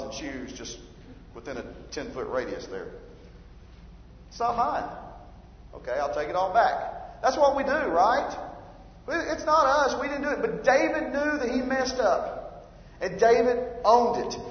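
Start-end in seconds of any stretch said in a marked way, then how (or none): 4.3-4.9 mock kid denial. Kid denying, voiced by dad. 9.1-10.4 whiny excuse. Retold or imitated. Mocking our excuses as kids.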